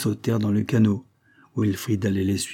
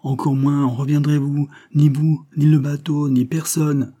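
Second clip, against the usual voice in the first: higher, louder emphatic warning; exclaiming; 5 semitones higher, 5 dB louder than usual. A narrator's reading. Old sailor predicting no one will return, exclaiming. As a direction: drive each item home with a raised voice. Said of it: "s'écria Bill Gand".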